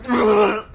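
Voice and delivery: high, loud pained groan as lava fills mouth; choking groan. Acted. Lava flooding his mouth, a loud choked groan.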